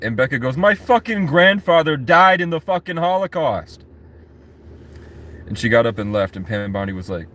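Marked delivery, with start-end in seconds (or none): 0.6-3.8 high-pitched indignant outburst; acted outrage. Senator answers antisemitism accusation, acted outrage. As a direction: fire back in a raised, imitated fury.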